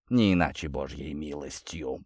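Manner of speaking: low, hushed murmur; whispered. A narrator's reading. Prince hearing of the Vinokurovs' arrival, whispering to himself. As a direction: drop the voice low and soft, almost to a whisper. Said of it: "прошептал князь".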